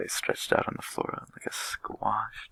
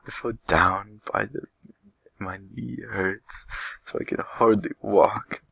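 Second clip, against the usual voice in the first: sobbing; 4 semitones higher, 4 dB louder, slower than usual. Acted. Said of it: "Melody sobbed".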